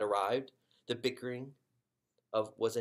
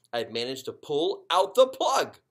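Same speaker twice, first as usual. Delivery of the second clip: strained, louder push; straining; 6 semitones higher, 12 dB louder than usual. A narrator's reading. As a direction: force it out with effort. Straining to pull the plug.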